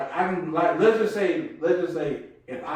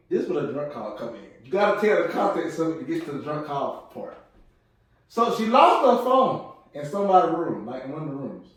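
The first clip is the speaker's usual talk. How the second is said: ordinary delivery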